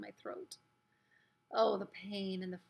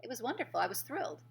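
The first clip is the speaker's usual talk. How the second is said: usual speaking voice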